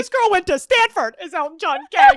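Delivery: high, incredulous teasing quote; mock disbelief. Family mocking the Stanford girl, high disbelief. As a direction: exclaim it up high, making fun of her.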